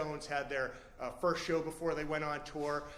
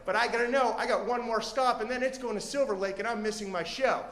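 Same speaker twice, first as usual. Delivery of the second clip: loud, higher, urgent rant; worked-up impatience; 5 semitones higher, 7 dB louder than usual. Acted. Comic fretting about missing his show, loud and agitated. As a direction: build a frantic case, pushing every phrase. Teller acts out mounting impatience.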